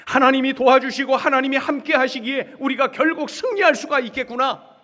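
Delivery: loud, high exclamation of faith; acted awed discovery; fervent realization. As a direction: cry out as the prophet who now understands. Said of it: "이렇게 바뀌었어요".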